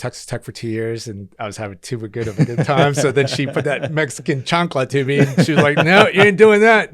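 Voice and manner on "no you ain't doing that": mom scolding. Retold or imitated. Mom scolding him, voiced.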